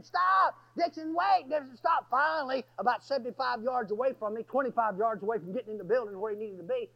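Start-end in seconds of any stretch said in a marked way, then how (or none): 0.0-2.1 high-pitched re-enacted shouting; yelling. Preacher trying to catch running Dixon, yelling. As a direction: call urgently for him to halt. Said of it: "I started yelling".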